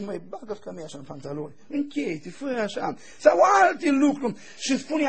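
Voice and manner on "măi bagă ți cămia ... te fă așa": much softer, quick, close to the ear; said in his ear. Retold or imitated. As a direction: murmur it privately, fast and soft. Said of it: "Dacă îi spuneai la urechi".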